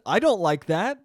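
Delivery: normal talking voice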